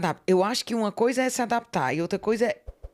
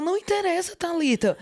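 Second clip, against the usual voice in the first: curt Portuguese dismissal; 9 semitones higher than usual. Retold or imitated. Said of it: "a pessoa".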